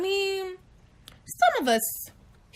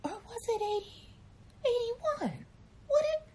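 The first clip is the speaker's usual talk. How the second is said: confused high voice; 12 semitones higher, 5 dB quieter than usual. Retold or imitated. Filling out a government form, high and confused. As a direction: sound lost, grasping for the year. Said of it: "I'll be like".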